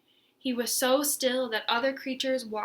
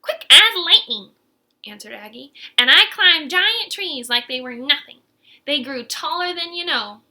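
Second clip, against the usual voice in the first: put-on boastful old snake; 3 semitones higher, 10 dB louder than usual. Aggie voiced in story.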